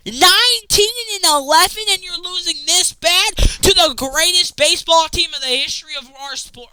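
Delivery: high, loud and rushing by the end; exasperated rant. Real, not acted. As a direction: tear into the team in disbelief. Ranting at the Padres.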